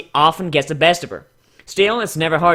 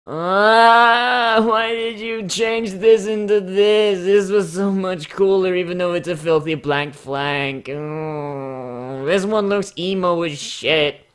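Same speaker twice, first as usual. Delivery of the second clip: slow, put-on whiny fan voice; lightly voiced complaining fan; mock disappointed fan; 3 semitones higher, slower than usual. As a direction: lament the redesign in a put-on voice. Voicing critic of the redesign.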